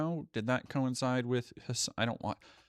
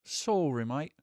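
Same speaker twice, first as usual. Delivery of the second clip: put-on British accent on mate; a mock British apology; playful British accent; 3 semitones higher, 4 dB louder, slower than usual. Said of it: "talking like this".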